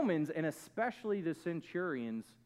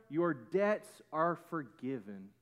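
usual speaking voice

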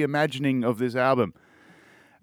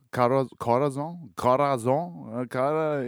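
Spanish accent. Shown as a demonstration. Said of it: "you gotta put the fucking accent on".

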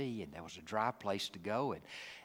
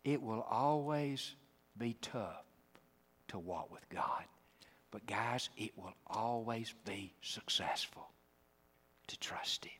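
slow, soft, whispered close; whispering the promise to the congregation; hushed whisper; 3 semitones lower, 8 dB quieter than usual. Real, not acted. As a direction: bring it down to an intimate whisper. Preacher drops to whisper.

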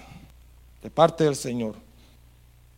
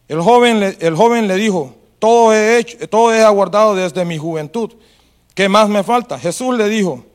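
louder, measured reading of the Gospel passage; scripture reading; 7 dB louder than usual. Acted. Preacher reading Matthew to the congregation, solemn and loud. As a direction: read the passage out solemnly to the church. Reads the Bible passage aloud.

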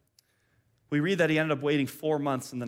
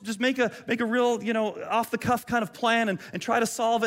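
sarcastic mock advice; 7 semitones higher, 4 dB louder than usual. Mocking venting on social media.

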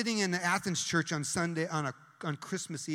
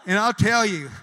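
much louder, a sudden proclamation; sudden loud burst; 3 semitones higher, 12 dB louder than usual. Real, not acted. Preacher to congregation, breaking into a loud proclamation. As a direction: belt it out to the room.

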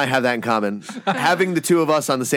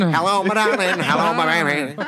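put-on old-timey voice. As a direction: croon it like an old record. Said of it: "Say that with an old-timey voice".

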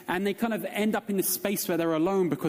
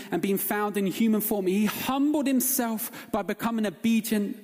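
a raised, measured Bible reading; scripture reading; 6 semitones higher than usual. Acted. Preacher reads Philippians to the congregation, raised and measured. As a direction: read Paul's words out to the church.

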